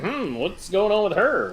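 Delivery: idly puzzled. Retold or imitated. Acting Arnold watching the girl, mildly puzzled. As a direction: wonder aloud like an oblivious bystander.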